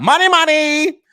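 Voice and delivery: money, money rising high. Real, not acted. Vlogger to viewers, gleefully singing out money. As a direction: sing out the money, delighted. Gloating about his money.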